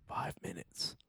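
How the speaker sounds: whisper. Acted. Joking aside about long time.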